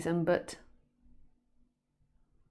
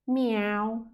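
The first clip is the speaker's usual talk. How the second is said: a sweet, plaintive meow; cute meow; 4 semitones higher than usual. Acted. Cat begging a woman for food, cute meow. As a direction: mew up at her sweetly. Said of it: "looked up at her face and went".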